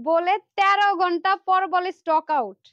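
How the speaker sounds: loud, high, drawn-out complaint; exasperated retelling. Retold or imitated. Seller complaining about a late stock-out reply, exasperated.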